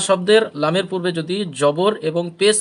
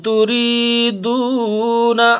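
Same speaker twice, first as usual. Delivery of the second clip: slow melodic tilawat, louder and higher; Quran recitation; 4 semitones higher, 4 dB louder, much slower than usual. Acted. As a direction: chant the verse in a drawn-out tajweed melody. Recites the verse. (ধীর সুরেলা তিলাওয়াত)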